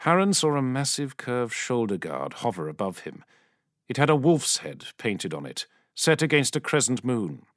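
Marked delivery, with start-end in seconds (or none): none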